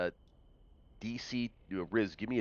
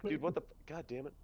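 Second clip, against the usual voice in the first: exasperated; 9 semitones higher, faster than usual. Acted. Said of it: "I'd be like".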